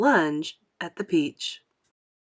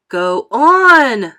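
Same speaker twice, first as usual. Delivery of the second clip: louder urgent shout; frantic cry; 5 semitones higher, 12 dB louder than usual. A narrator's reading. Creatures crying frantically at James. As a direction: yell at him to speak. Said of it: "they cried frantically".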